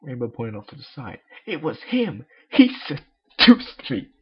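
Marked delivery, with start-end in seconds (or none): 1.5-4.1 much higher, much louder, slow outcry; wild accusation. Acted. Rainbow dramatically accuses to Miss Cake. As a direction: cry it out dramatically.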